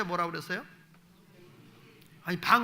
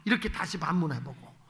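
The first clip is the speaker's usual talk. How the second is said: ordinary delivery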